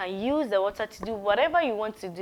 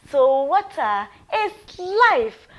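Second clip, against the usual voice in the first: "water is life" said slowly and louder; slow emphasis; 4 semitones higher, 5 dB louder, slower than usual. Shown as a demonstration. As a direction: lay it down slowly so it sticks. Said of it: "you have to note that".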